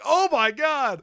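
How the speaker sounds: loud, high, drawn-out exclamation; amazed outburst. Retold or imitated. Retelling the wrestler's reaction to a fan, amazed outburst. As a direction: imitate him losing it, slowly and loudly.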